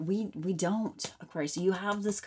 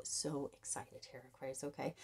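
whispering; 4 semitones lower, 12 dB quieter than usual. Real, not acted. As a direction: whisper it, thrilled. Hushed excitement.

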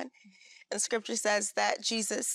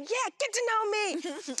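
eager reply; 10 semitones higher, slower than usual. Retold or imitated. Voices God answering her eagerly.

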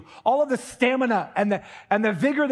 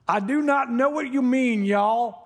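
higher-pitched put-on Southern drawl; strong Southern accent; 4 semitones higher than usual. Preacher imitating Peter denying it, strong Southern accent. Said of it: "coming back with a strong Southern accent".

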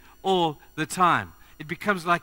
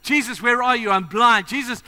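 higher and louder desperate calling; loud crying out; 5 semitones higher, 6 dB louder than usual. Retold or imitated. Blind beggar crying out to Jesus over the crowd, loud and desperate. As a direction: call out desperately across a crowd. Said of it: "with a very loud voice".

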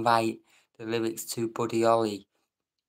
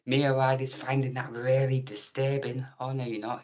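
ordinary delivery

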